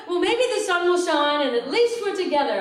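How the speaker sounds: high, loud forced cheeriness; mock-chipper optimism; forced cheer. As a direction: chirp it brightly to cheer her. Trying to be cheery.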